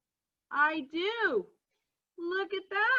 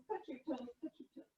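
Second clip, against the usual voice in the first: almost gone, softer echo; faded quiet; 14 dB quieter, much faster than usual. Acted. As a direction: let the cue fade away. Exercise leader, echo fading.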